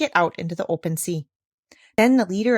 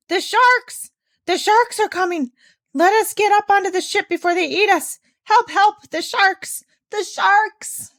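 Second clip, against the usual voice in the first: loud, much higher panicked cries; crying out in fear; 12 semitones higher, 5 dB louder than usual. A narrator's reading. Pirates in the water fleeing sharks, panicked cries. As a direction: scream for help from the water. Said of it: "they all cried out in great fear".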